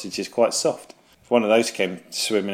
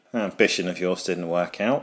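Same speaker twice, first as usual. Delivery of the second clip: normal talking voice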